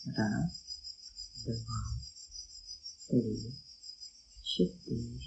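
soft, slow breathing count. Acted. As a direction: count the breaths softly and calmly. (медленный счёт дыхания)

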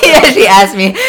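high and loud through laughter; cracking up as you tell it; real laugh bubbling over the words. Real, not acted. Laughing retelling.

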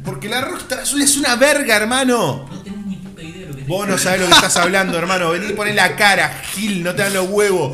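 mock angry. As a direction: pick a fake fight. Mock heated defence of drink. (enojo en broma)

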